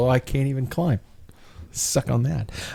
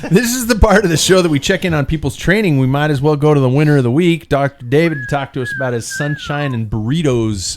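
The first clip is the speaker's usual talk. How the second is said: host intro; 4 semitones higher, 5 dB louder than usual. Real, not acted. Host announces the show segment.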